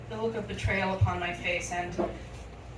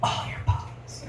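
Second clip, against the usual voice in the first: slow, menacing whisper; mock-sinister hushed demand; ruthless counter-demand. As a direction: whisper it slowly like a tiny extortionist.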